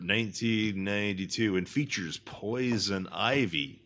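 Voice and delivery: grand announcer read, key words drawn out; episode announcer. Acted. Host introducing tonight's episode, showy announcer.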